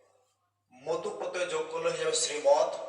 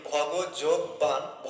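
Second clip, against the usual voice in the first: slow spelled-out parts; much slower than usual. Shown as a demonstration. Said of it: "যোগ যদি direct বান".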